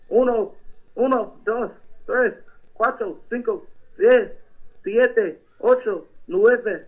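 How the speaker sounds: put-on Mexican accent, lightly done. Prank caller Julio asks a pastor about mayor, Mexican accent. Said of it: "Count to ten for me in Spanish".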